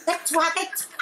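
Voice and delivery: high-pitched urgent plea to stop; frantic retold plea. Passenger on the Vespa telling the driver to stop, frantic and high.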